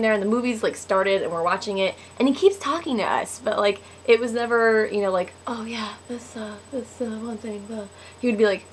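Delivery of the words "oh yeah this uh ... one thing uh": very soft, half-whispered mumbling; imitated movie-theater murmur; mock murmured chatter. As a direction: mumble vague small talk under your breath. Mimes low chatter during movie.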